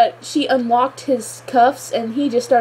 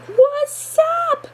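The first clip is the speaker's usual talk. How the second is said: excited squeal. Real, not acted. Gushing over the flashback reveal.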